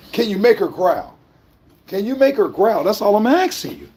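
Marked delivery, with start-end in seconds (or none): none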